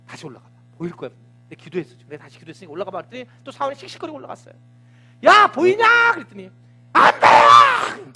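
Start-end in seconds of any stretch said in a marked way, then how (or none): 0.1-3.0 hushed urging. Acted. Elijah quietly urging servant. 5.2-6.1 calling up. Acted. Elijah calling up the hill. 6.9-8.1 high drawn-out yell of an annoyed servant; annoyed shout. Acted.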